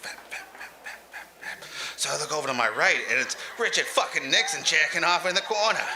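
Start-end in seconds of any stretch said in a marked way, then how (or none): 0.0-1.7 very soft, voiceless sound effect; hushed rhythmic mimicry; imitated thudding noise. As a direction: whisper the repeated thumps.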